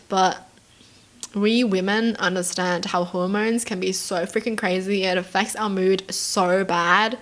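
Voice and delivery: ordinary delivery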